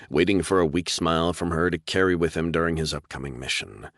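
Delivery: ordinary delivery, nothing marked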